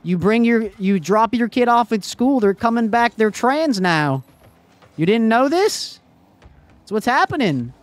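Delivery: loud, high-pitched incredulous rant; mock conspiracy believer. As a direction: play a worked-up podcast-fed alarmist. Plays a ranting conspiracy believer.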